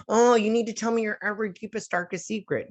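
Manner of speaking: high, whiny mimicry; mocking demand. Mocking what everybody demands.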